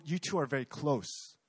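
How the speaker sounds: normal talking voice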